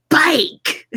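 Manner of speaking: high, loud Brummie "Bake!"; bright Birmingham accent shout. Retold or imitated. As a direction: belt it like the Birmingham baking-show host. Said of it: "She's got the Brummie accent".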